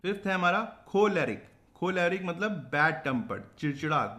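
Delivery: usual speaking voice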